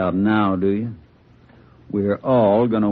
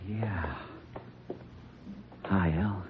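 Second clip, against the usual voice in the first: soft greeting; 15 dB quieter, much slower than usual. Acted. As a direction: greet the brother gently. Gunman greets his brother.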